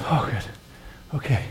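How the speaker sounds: soft, breathed-out relief; relieved. Acted. Preacher acting out learning his sins are forgiven, relieved. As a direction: let it out quietly, nearly voiceless. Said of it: "That brings me to a place of".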